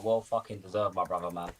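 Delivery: usual speaking voice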